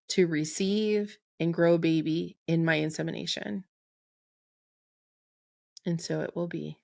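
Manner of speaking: spoken affirmation. Shown as a demonstration. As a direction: lay each request down gently, like a prayer. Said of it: "I'm gonna say this 'cause it's really beautiful".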